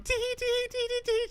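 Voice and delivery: high impish tee-hee; mischievous giggle. Retold or imitated. Husband fleeing the room, high impish giggle. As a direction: giggle high like a naughty kid.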